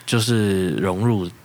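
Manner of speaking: ordinary talk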